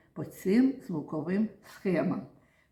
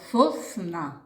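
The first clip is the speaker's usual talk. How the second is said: "сосна" broken into сос and на; "сосна" syllable by syllable; split into syllables; 4 dB louder than usual. Shown as a demonstration. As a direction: say "сосна" as two separate beats, сос, на. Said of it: "поділимо на склади слово".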